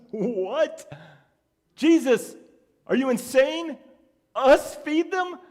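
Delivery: sarcastic disbelief. Retold or imitated. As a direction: scoff as if he's gone mad. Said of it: "that sarcastic response".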